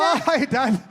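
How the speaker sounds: high, bright fake surprise; mock surprise. Comic spotting his dad in the crowd, high mock surprise. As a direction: act caught out greeting dad. Greeting grandad in the audience.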